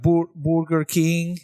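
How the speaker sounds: put-on Burger King as a drawn-out "BOOR-ger KEENG", Spanish-accented. Imitating Spanish speakers for a laugh, Burger King as "BOOR-ger King". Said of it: "Spanish is their first language".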